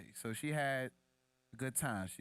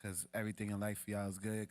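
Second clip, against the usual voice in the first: ordinary delivery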